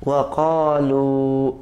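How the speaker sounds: Wakalu stretched very slowly in Arabic style; stopping recitation. Shown as a demonstration. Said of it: "Bapak berhenti". (Wakalu dibaca pelan saat berhenti)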